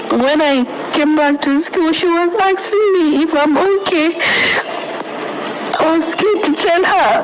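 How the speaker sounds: tearful, voice pitched high and breaking; real tears while recounting the ordeal; crying. Real, not acted. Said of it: "I just have to cry".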